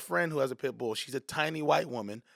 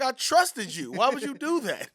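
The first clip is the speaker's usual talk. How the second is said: high-pitched mock hurt; betrayed protest; 8 semitones higher than usual. Man to the cat that grabbed him, betrayed. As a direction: plead with comic betrayal, pitch climbing. Reproaching the cat after attack.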